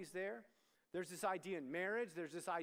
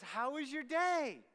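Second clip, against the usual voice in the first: higher, louder, drawn-out mom greeting; imitating cheery mom; 7 semitones higher, 7 dB louder, slower than usual. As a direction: sing out the question as an eager mom. Mom's dreaded after-school question, voiced.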